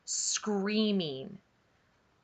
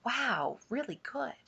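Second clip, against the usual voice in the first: soft, offhand praise; passing admiration; 3 semitones higher, 7 dB quieter than usual. A narrator's reading. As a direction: toss it off quietly like a passerby. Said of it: "people passing on Dump Road".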